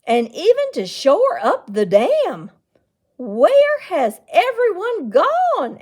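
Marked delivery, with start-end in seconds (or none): 3.1-5.8 loud, high, slow astonished question; stunned amazement. Acted. Aunt seeing the empty town, stunned and slow. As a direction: let the shock stretch each word. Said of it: "in stunned amazement".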